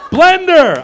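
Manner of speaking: high loud call, half laughing; laughing surgeon call. Acted. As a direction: yell for the blender like it's a real tool. Surgeon calling for instrument.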